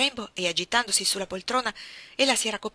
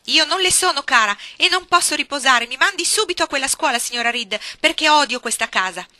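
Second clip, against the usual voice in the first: più alta e più forte, rabbia che cresce; accusa furiosa; 7 semitones higher, 12 dB louder than usual. A narrator's reading. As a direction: getta ogni parola come un'accusa. Jane's outburst. (Jane accusa la signora Reed, furia)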